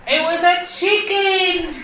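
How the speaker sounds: very high, loud insistence; mock quarrel yell. Wife insisting it was a chicken, mock quarrel yell. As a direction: squeal it back in a comic argument.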